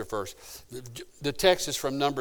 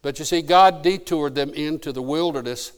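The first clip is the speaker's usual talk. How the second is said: ordinary talk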